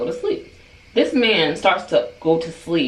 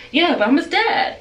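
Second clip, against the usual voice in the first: imitated defensive dad; 11 semitones higher than usual. Father defending himself, retold.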